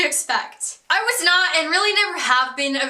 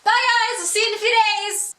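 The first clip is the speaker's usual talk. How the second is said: high, cheery mock farewell; acted chirpy send-off; happy goodbye; 6 semitones higher than usual. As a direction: call a happy goodbye to the parents, pitched up. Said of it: "happily waved goodbye".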